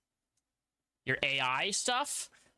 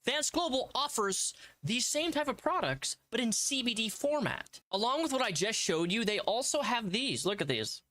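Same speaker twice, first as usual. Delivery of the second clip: hammed-up sponsor pitch; upbeat salesman voice; ad read; 6 semitones higher than usual.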